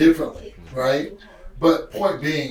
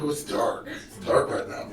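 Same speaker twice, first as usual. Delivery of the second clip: breathy near-whisper, spent and heavy; drained whisper; 2 semitones lower, 7 dB quieter than usual. Retold or imitated. Dad drained after sessions, voicing his dark mood in a whisper. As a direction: let it out like the day emptied you.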